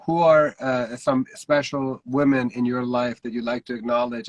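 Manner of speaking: normal talking voice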